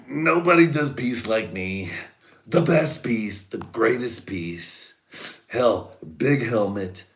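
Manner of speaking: high, loud, slow mock Trump boast; Trump impression. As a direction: ham up the bragging president. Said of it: "Trump was like".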